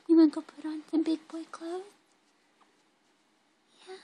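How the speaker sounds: high, loud, quick question. Real, not acted. Coaxing baby to get dressed.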